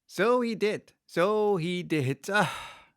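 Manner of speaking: high, slow sighing Griffin. A narrator's reading. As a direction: sigh it out wistfully. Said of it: "sighing in his turn".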